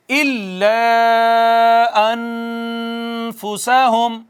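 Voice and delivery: إِلَّا with a strong doubled ل, slow chant; slow Quran recitation; stressed shadda. Shown as a demonstration. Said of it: "شد کو زور سے پڑھا".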